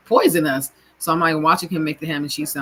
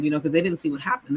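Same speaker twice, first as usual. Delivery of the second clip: ordinary delivery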